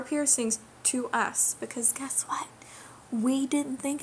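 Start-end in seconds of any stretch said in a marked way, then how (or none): 1.6-2.5 hushed conspiratorial whisper. Real, not acted. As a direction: lean in and whisper the secret. Secret aside in a rant.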